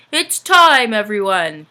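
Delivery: rally the helpers; Santa calling out. Acted. Santa calling his elves to work, loud.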